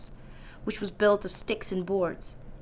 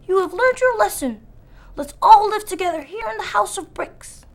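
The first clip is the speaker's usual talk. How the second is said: high, loud pleased pig voice; kindly wise pig; 11 semitones higher, 6 dB louder than usual. A narrator's reading. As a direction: gently lecture then welcome. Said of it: "said the wise little pig".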